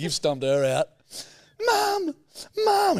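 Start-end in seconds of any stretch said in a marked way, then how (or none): 1.6-3.0 much higher wailing call for mum; crying chick. Pretend. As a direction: whimper like an injured little chick.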